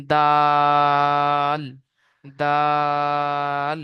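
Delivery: দাল with the ā drawn long, stopping on l; long dal, stopped at lam. Shown as a demonstration. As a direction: stretch দাল then stop cleanly. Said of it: "লামে গিয়ে আওয়াজ থেমে যাবে". (দা লম্বা টেনে লামে থামা)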